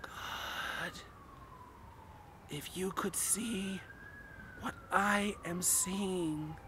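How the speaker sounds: trembling whisper, slow and hushed; shaky whisper. A narrator's reading. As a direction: breathe it out, terrified of what you see. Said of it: "in a shaky whisper".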